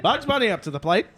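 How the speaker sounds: high, loud, laughing play-by-play; baseball announcer. Acted. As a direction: call the pitch like an old radio announcer. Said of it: "Baseball announcer".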